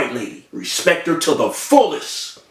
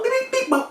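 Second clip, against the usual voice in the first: high, softer little beeps; imitated beeps; 5 dB quieter than usual. Storyteller mimics the nurse doctoring him, imitated beeps. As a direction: mimic quick busy beeping, high.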